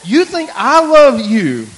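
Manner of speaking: high, loud, slow disbelief; hammed-up incredulity; retold incredulous outburst. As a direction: stretch the question out in loud mock disbelief. Retelling his incredulous reply to daughter.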